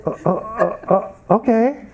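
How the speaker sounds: halting, taken-aback stammer; stammer, then meekly give in; mock startled stammer. Kitchen worker yelled at by students, mock startled stammer.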